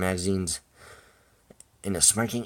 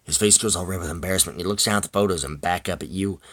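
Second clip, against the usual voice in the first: ordinary delivery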